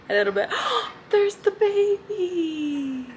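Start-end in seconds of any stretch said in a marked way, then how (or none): 0.5-1.0 sharp high delighted gasp. Real, not acted. Spots baby eagles on feed. 1.1-3.1 high, slow, cooing. Real, not acted. Cooing at baby eagles.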